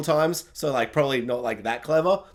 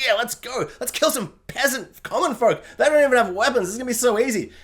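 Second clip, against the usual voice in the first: mock eager guard; 6 semitones higher than usual.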